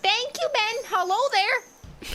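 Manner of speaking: high, hammed-up Canadian accent, eh. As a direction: play up a cheery Canadian accent. Canadian bit continues.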